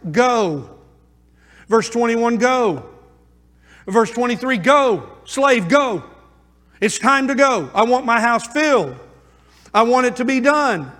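loud, driving pulpit delivery; preaching at full force. Acted. Pastor urging his congregation to go and invite, preaching loud. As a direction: preach it out to the whole room.

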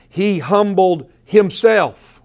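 slow, weighty HUMBLED, then HIMSELF set apart; emphatic stress. Shown as a demonstration. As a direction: drive home "He humbled himself" word by word. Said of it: "I'm emphasizing this".

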